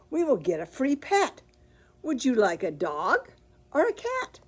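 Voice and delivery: lively, animated picture-book reading; reading to children. Acted. Bookstore host reads Amelia Bedelia to children, animated storytelling. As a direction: read it out big for the kids at story time.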